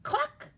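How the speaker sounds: high, quick cluck; chicken cluck. A narrator's reading. Chicken greets the truck, high cluck. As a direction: imitate a hen with one high cluck. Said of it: "said a chicken".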